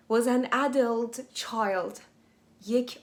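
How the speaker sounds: ordinary talk, nothing marked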